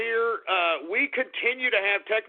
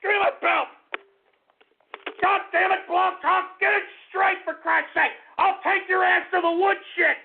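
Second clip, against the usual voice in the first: furious threat; 8 semitones higher than usual. Acted. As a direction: threaten to take them to the woodshed. Mock-threatening the broadcast platform.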